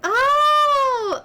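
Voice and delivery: high, loud surprised oh. Acted. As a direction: react with big surprise. NPC surprised at the distance.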